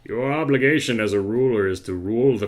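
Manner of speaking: normal talking voice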